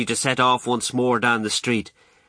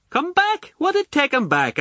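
very high, scoffing question; incredulous echo. A narrator's reading. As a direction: throw the question back in high-pitched disbelief. Guest scoffs at the suggestion.

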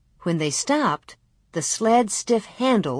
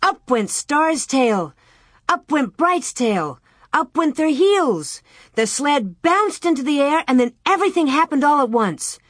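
narration rising higher, ending in a rush; building excitement; 6 semitones higher than usual. A narrator's reading. Narrator tells of calves bolting, excitement building. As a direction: build suspense, then speed up.

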